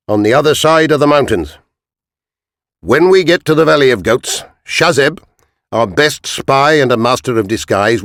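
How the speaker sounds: a lightly voiced commander addressing his men; a touch of an army captain rallying men; captain's orders. Captain gives marching orders.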